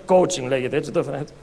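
normal talking voice

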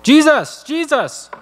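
urgent high-pitched calling through a door; Peter. Acted. Peter calls to Jesus from outside the door, urgent high call. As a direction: call out the name high, insistent and falling. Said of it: "He knocks on the door".

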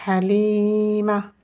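"Halima." slowly, with a long level i and short final a; feminine form. Shown as a demonstration. Teacher repeats the feminine name, "Halima." with long i. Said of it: "d'un prénom féminin".

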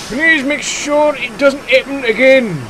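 loud, high, slow official warning; stern. A narrator's reading. Inspector warning the quarry manager, stern. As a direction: give a firm, drawn-out telling-off. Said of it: "said the inspector sternly".

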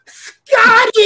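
high, loud yell of the name. Retold or imitated. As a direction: call out his name across the room, full voice. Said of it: "I yell out".

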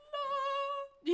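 loud, very high sustained 'no'; high mock cry. Shown as a demonstration. Streamer demonstrating the hammer swing, high drawn-out cry. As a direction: hold one high, loud note. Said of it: "What, just like".

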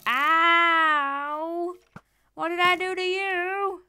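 high, loud, wounded skeleton whining; a skeleton yelping in outrage at being attacked; hurt skeleton voice. Pretend.

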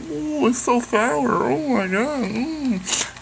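very high, slow silly voice; hammed-up cry at the sour taste; mock sour reaction. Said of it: "The bonkers go".

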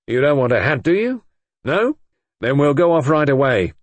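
brisk coaxing. A narrator's reading. Inspector leading Brenda off after the arrest, briskly coaxing. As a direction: hurry her along, leaving no room to argue.

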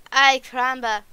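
loud exasperated exclamation; exasperated outburst. Acted. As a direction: blurt it out loud, fed up.

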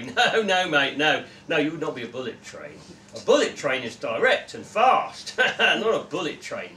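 loud, pushy mate voice; hearty bloke, needling his friend; brash Gary voice. Acted. Gary laughing at Joe.

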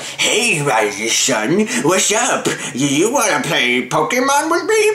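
exaggerated retainer-slurred voice; retainer lisp. Shown as a demonstration. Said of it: "sound like".

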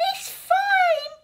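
very high, loud squeaky crying voice; a tiny sprite squeaking through tears. Acted. Tiny sprite squeaks to Tsar after rescue, high and tearful. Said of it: "squeaked Squeeze Juice".

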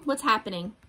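ordinary delivery, nothing marked

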